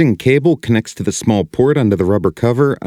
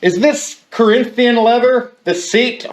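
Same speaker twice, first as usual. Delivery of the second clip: high raised voice calling through the house; 13 semitones higher than usual. Pretend. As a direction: call the question to the next room. Calling question to the bathroom.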